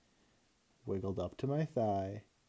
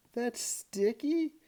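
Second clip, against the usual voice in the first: much higher, slow stunned question; shocked disbelief; 13 semitones higher, 3 dB louder, much slower than usual. A narrator's reading. Mom stunned the gecko is Sticky, slow shocked gasp. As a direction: stretch it out in disbelief. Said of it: "my mom gasped".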